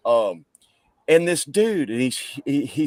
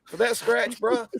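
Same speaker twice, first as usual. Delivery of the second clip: higher, scoffing tough-guy voice; dismissive tough guy; 11 semitones higher than usual. Retold or imitated. Wounded guy shrugging off his gash, scoffing. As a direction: brush off the wound as nothing. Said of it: "He's like".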